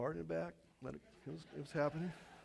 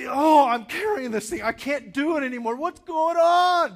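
anguished shout under weight; 10 semitones higher, 13 dB louder than usual. Retold or imitated. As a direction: groan it out as if crushed by a load. Acting out crushing burden.

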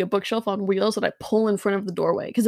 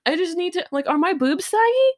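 higher, sheepish apologetic voice; mock sheepish; 7 semitones higher than usual. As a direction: ask it like a nervous girl. Awkward high apologetic question.

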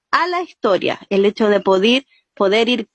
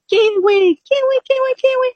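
much higher, head-voice kiwi, kiwi; high tone call; 13 semitones higher than usual. Shown as a demonstration. Teacher guiding her students through the high fruit tone. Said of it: "la idea del ejercicio es que yo los voy guiando".

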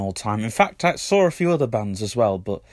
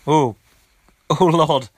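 pained exclamation; 6 semitones higher, 6 dB louder, slower than usual. Real, not acted. Sniffing hurt her nose.

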